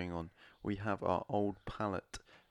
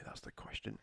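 whispered aside; 11 dB quieter than usual. Pretend. Dramatic commentary aside.

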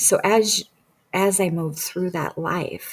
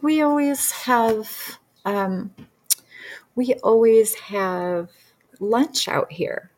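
higher, slow, drawn-out reply; lightly voiced lady; 5 semitones higher, slower than usual. Young lady to practitioner about lunch with friends, lightly acted. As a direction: imitate a lady leisurely explaining her habit.

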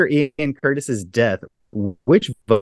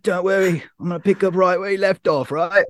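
higher, quick cocky rock-star voice; 8 semitones higher than usual. Retold or imitated. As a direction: play a smug singer lightly. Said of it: "he's like".

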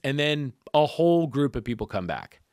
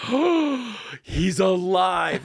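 sharp gasp, high "oh", drawn-out amazement; gasp, then stretch it out in wonder; 5 semitones higher, 3 dB louder, much slower than usual. Retold or imitated. Visitor seeing the patient's eye open, gasping astonishment.

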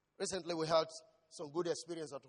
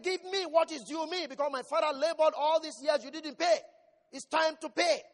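much louder and higher, building to a shout; preaching at full force; 8 semitones higher, 8 dB louder than usual. Acted. Preacher rousing the church about resting in God, building shout. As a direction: thunder it at the church.

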